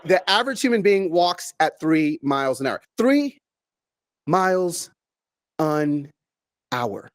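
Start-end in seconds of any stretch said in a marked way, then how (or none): 3.0-7.1 one word at a time, long pauses; word-by-word slowness. Shown as a demonstration. Speaker showing the audience walking pace, slow and spaced. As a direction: say it at a strolling pace, each word alone.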